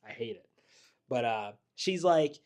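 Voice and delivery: ordinary talk, nothing marked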